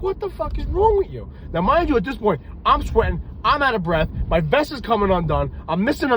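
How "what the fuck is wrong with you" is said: pitched far up, outraged disbelief; exasperated disbelief. Retold or imitated. Cop to partner after pillow hit, high exasperated outburst. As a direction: squeal at the partner who smacked you. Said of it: "I'm like".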